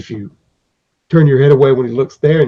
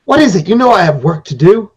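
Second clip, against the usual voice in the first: higher, louder irritated snap; an interrupted craftsman snapping at visitors; grumpy craftsman; 5 semitones higher, 3 dB louder than usual. Acted. Craftsman annoyed at visitors at his door: higher irritated snap.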